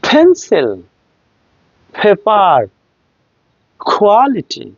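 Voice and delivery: পেন্সিল, পেপার, কোয়ালিটি, each opening on a strong puff; aspirated stops. Shown as a demonstration. Teacher making the prop move, aspirated পেন্সিল, পেপার, কোয়ালিটি. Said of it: "aspirated sound দিয়ে বলছি".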